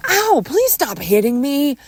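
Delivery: high, loud, drawn-out plea; yelped plea to stop; pained protest. Acted. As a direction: cry out while being hit. Being hit.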